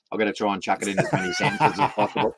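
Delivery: fast, amused quote of someone keen on a new word; mock eager self. Eager learner showing off a new word, fast and amused. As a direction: rattle it off with a laugh. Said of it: "you go".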